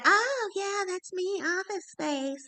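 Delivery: a light parody of her being proud; mocking imitation. Best friend teasing her about the Blockbuster mix-up, mock-proud voice. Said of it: "my friend never let me live it down".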